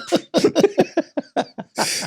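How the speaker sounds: high, helpless laughing fit; high-pitched real laugh; real laughter. Real, not acted. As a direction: laugh freely at the joke. Both laugh at the joke.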